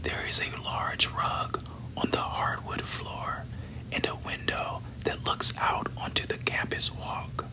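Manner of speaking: plain reading voice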